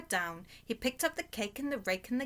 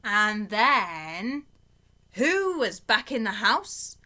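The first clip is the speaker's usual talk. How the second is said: usual speaking voice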